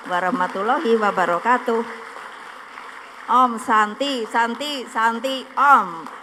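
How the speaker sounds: calling out closing greetings to a crowd; loud greeting. Real, not acted.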